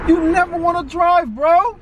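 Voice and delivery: exasperated shout. Real, not acted. Arguing back at girlfriend.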